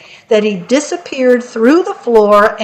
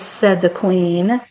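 plain reading voice

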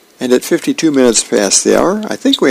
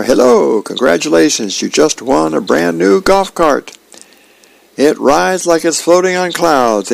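upbeat, higher-pitched salesman on the phone; prize caller; 6 semitones higher than usual. Acted. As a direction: gush like you're announcing a big prize.

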